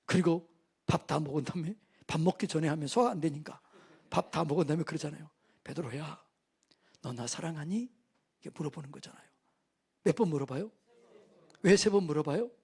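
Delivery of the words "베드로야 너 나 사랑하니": soft, low, gentle asking; tender question. Acted. Jesus asking Peter after breakfast, soft and tender. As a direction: ask it quietly like a loving teacher.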